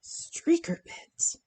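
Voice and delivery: slow breathy whisper of the phrase, amused. Real, not acted. Reading a viewer's comment to herself, whispered slowly. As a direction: savor the words in a hushed whisper.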